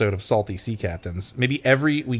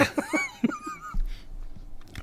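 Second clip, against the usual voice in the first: giggling fit; breathless high laugh; high squealing laugh; 12 dB quieter than usual. Real, not acted. Laughing at the bit.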